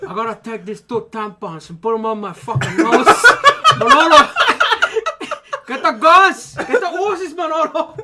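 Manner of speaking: put-on loud Cuban gangster accent, laughing; Al Pacino Scarface impression. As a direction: shout it like Tony Montana. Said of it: "you gotta do it as Al Pacino".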